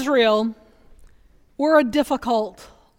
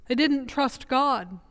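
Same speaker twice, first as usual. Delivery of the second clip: softer and slow, "didn't" let down gently; quiet repeat; 2 semitones lower, 7 dB quieter, slower than usual. Shown as a demonstration. Preacher to congregation, softly repeating the refrain. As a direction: let the line drop away in sad resignation.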